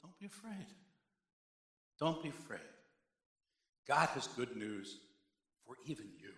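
soft, hushed angel voice; an angel softly calming terrified shepherds; gentle angel. Acted. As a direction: comfort the frightened shepherds gently. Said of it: "and the angel said".

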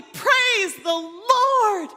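slow, high, bold shout; loud, unabashed praise. Acted. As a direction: cry it out boldly, holding each word. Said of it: "it says, loudly, loudly, unabashedly, boldly".

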